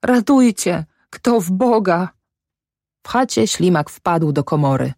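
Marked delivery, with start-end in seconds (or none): 0.1-2.1 high plea sinking as it goes; moaning. A narrator's reading. Mother in spasms of weeping, moaned plea for help. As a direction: moan for help, losing force. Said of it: "jęcząc".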